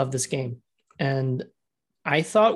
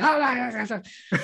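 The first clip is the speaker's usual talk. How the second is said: high, laughing mock screech of the cat lady; crazy cat lady; 9 semitones higher than usual. Mocking the crazy cat lady from the Simpsons for a cohost. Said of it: "crazy cat lady and the Simpsons".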